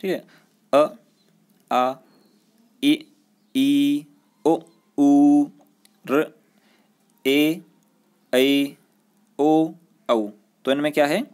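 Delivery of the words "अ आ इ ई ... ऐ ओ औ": each vowel said alone, drawn out; vowel recitation. Shown as a demonstration. Teacher reciting the Hindi vowels one by one for students. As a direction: sound out every vowel clearly for the class. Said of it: "जैसे आपने बोल के देखी एक बार".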